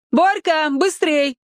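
high voice calling over a fence; child calling. A narrator's reading. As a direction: call out to Borka from afar. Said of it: "донеслось из-за забора". (высокий детский оклик)

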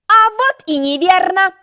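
high, slow gloating; an egg delighting in catching her out; triumphant. A narrator's reading. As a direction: crow over her mistake. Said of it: "торжествовал".

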